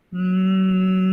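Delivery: a long steady "mm" hum; humming exercise. Shown as a demonstration. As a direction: hum so the face vibrates. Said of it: "como por ejemplo hacer el".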